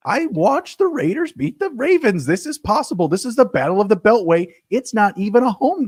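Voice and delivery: high-pitched, worked-up insistence; excited sports-fan pleading; impassioned argument. Real, not acted. Hyped argument urging co-host to bet.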